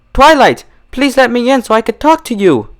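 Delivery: high, anxious plea; pleading Rarity. Acted. Rarity pleading with her strange friend. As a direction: plead gently but urgently.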